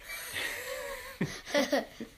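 very high, squeaky laugh; high kangaroo giggle. Acted. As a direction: giggle away in a tiny pitch. Kangaroo laughs at own name.

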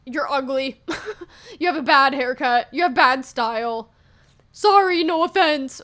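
mocking a troll. Imitating a rude commenter on her page, snide and loud. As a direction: sneer it like a fake-sorry troll. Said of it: "being like".

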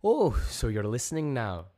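pointed, high-pitched retort; indignant retort. A narrator's reading. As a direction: throw it back at them loudly. Joni retorts to the alien voice.

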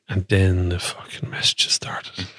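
hushed, dropping to a whisper; whispered aside. Real, not acted. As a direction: breathe it out like a dark secret. Ominous aside about group messages.

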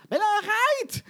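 very high, loud mock shriek; teen screech. Daughter cuts father off, exaggerated high shriek. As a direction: cut in like a hysterical teenager.

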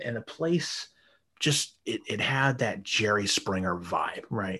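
usual speaking voice

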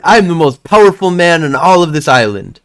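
put-on child showing off to a stranger; boastful kid. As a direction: brag like the strongest boy around. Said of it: "he's like".

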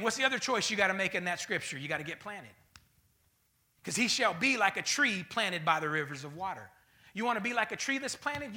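normal talking voice

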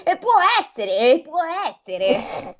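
storybook crow mulling it over; pensive crow. Acted. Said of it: "mosse la testa pensierosa e disse".

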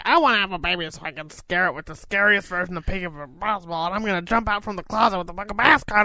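fast, mocking silly parent voice; mocking partner's plan, fast and silly. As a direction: rattle it off as a clueless parent. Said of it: "You're like".

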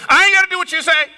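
high, fast imitated child's backtalk; sassy little brother. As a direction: talk back like a defiant little kid. Replays young brother's backtalk.

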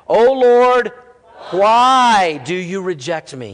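loud, slow plea to God; crying out. Retold or imitated. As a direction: cry out as the anguished psalmist, stretching the why. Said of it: "he's crying out".

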